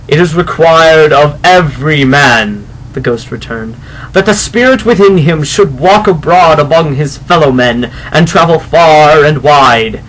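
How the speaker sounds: slow, loud, booming spectral pronouncement; solemn ghost. Acted. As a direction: intone it like a phantom from beyond. Ghost explains its doom.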